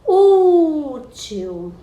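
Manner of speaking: Ú in "Útil" held long, loud and high; loud, stretched first syllable Ú in "Útil"; calling out the word. Shown as a demonstration. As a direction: call out "Útil" on a long falling Ú. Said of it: "é só você chamar a palavrinha".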